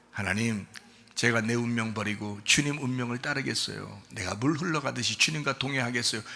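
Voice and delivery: soft, low, even surrender to God; simple quiet prayer. Shown as a demonstration. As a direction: say the vow calmly and without strain. Models the simple prayer instead. (조용하고 담담한 순종의 기도)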